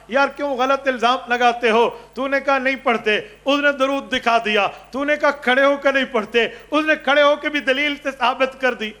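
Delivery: high, forceful mock telling-off; retold scolding. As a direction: take the accuser to task, voice up. Principal scolds the accusing teacher.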